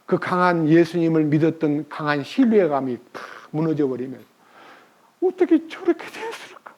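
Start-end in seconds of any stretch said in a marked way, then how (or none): 5.2-6.7 dismayed wondering. Retold or imitated.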